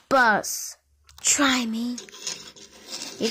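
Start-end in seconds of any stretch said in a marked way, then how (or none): 1.2-2.0 low whispered challenge; whispered dare. Pretend. Bus daring the crazy-bus remark, whispered.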